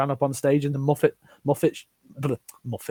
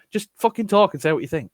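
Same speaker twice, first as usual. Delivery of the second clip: ordinary delivery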